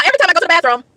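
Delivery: normal talking voice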